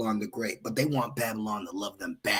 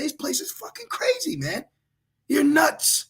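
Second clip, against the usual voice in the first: exasperated exclamation; 14 semitones higher than usual. Real, not acted. Preacher to his listeners, exasperated high exclamation. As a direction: throw the line up high in disbelief.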